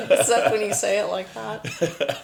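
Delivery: said through real laughter; loud, amused words breaking out of a laugh. Real, not acted. Host teasing guest about his bad-guy remark, laughing.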